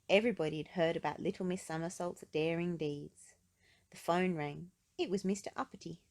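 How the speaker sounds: ordinary delivery, nothing marked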